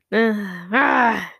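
drawn-out straining grunts; aggressive effort grunts. Retold or imitated. Turning the wheel aggressively, straining effort grunts. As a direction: grunt as if wrenching something round.

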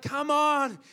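high, loud frustrated urging; exasperated urging. Retold or imitated. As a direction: coax it with impatience. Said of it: "And you're like".

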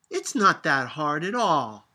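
put-on loud child's shout. A narrator's reading. Sophia shouting back at grandmother in defiance. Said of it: "Sophia shouted".

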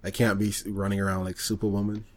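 ordinary talk

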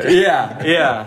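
laughing, high and loud; real laugh on yeah. Real, not acted. Laughing at a joke.

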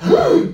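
sharp high indignant gasp; old man spotting kid on his lawn, gasping; mock gasp. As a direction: gasp in outrage. Old man sees kid on grass.

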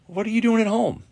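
high, disbelieving question to dad; high incredulous question to dad on the phone. Retold or imitated. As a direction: ask it in shocked disbelief.

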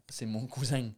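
accent de Marseille répété lentement; essai d'accent marseillais. Shown as a demonstration. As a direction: copie lente de l'accent marseillais, finale en «-eng». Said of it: "en marseillais avec l'accent". (accent marseillais appliqué)

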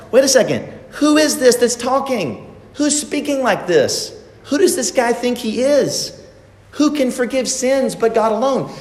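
high-pitched, affronted challenge; indignant Pharisees. Acted. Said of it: "You can see the response of the Pharisees and the scribes".

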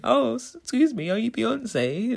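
high, slow mock pickup line; imitating a flirty guy. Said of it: "he was, uh, saying".

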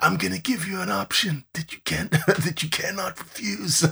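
a hint of a Godfather mob boss; lightly mock mafia boss, making an offer; Don Corleone. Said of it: "Get your Don Corleone on".

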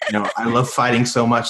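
ordinary talk, nothing marked

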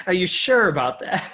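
giggling joking reply; laughing. Real, not acted. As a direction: laugh it out while mock-doubting. Host joins the joke laughing.

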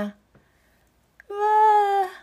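high drawn-out stretching "waa"; big stretching cry. Acted. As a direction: let out a long high stretch sound. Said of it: "大きな背伸びを一つすると".

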